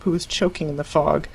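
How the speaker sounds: ordinary delivery, nothing marked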